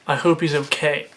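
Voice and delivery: usual speaking voice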